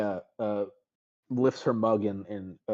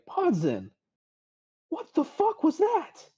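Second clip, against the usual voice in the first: much higher, slow, stunned demand; shocked friend; slower than usual. Acted. Bonnie confronting Podzin after the magic, stunned.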